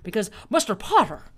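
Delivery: loud, fervent old-movie hero impression; George Bailey. Shown as a demonstration. Urging sweetheart to come along, old-movie hero impression. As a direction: build to an impassioned film-hero outburst. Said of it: "I can do George Bailey great".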